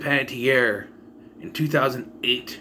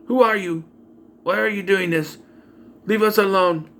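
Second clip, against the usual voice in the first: raised pitch, same tune on every line; monotone acting; 4 semitones higher than usual. Actress playing babysitter on the phone, monotone. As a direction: imitate a wooden actress reciting lines. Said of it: "It's just monotone".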